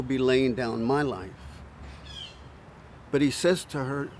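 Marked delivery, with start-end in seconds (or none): none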